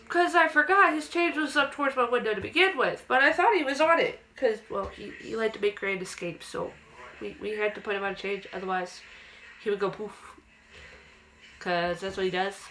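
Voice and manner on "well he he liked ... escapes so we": normal talking voice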